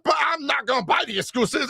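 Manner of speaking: hammed-up high complaining; mocking fan voice. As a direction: whine it like a spoiled gamer.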